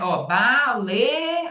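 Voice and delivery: very slow, drawn-out start of the word; slow prompting hint. Shown as a demonstration. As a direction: stretch the first syllable to coax the child. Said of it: "você fala, ó, como é?".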